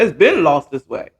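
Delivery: usual speaking voice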